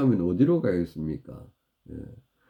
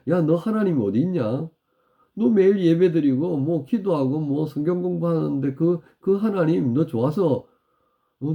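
higher-pitched mocking challenge; imitating enemies' sneering taunts; jeering taunt; 6 semitones higher than usual. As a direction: needle a believer with scornful questions. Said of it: "비아냥까지 하더라는 겁니다".

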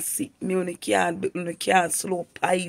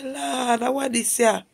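much higher, alarmed "Lord, what this here?"; startled cry to the Lord; 7 semitones higher, slower than usual. Retold or imitated. Woman retelling finding slime in the rice, startled and high. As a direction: gasp it out as you find slime in the pot.

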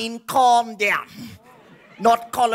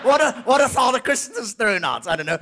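ordinary delivery

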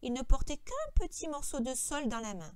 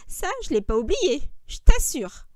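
loud, higher gleeful boast; joyful snickering; 5 semitones higher, 14 dB louder than usual. A narrator's reading. Lenny gleefully remembering for George, joyful. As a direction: crow it with glee. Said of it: "Lenny ricana joyeusement".

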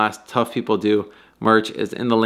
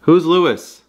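raised voice asking the spirit; calling out; 5 semitones higher than usual. Real, not acted. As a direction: call out to an unseen presence.

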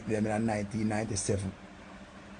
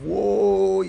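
excited whoa; 6 semitones higher, 6 dB louder than usual. Acted. Excitement over Stacy.

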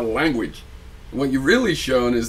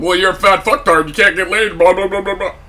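loud, higher mock ranting; mock insults; 7 semitones higher, 7 dB louder than usual. Vlogger imitates rude opponents insulting a civil debater, loud mock tirade. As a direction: imitate someone hurling abuse, running into blahs. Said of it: "and then they're called".

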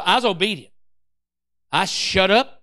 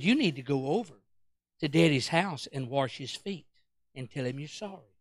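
low, soft, measured wife; hushed, firm instruction; calm admonishing; 8 semitones lower, 9 dB quieter than usual. Retold or imitated. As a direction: quietly lay down what he must do. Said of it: "she starts talking like that".

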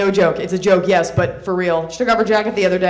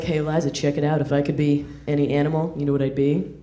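dudebro voice; 6 semitones lower, 4 dB quieter than usual. Retold or imitated. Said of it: "he was like".

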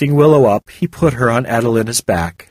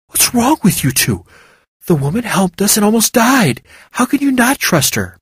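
a higher, indignant Willow; Willow voiced; 11 semitones higher than usual. A narrator's reading. Willow scolds her companions for distrust, higher indignant voice. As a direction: defend the woman, exasperated.

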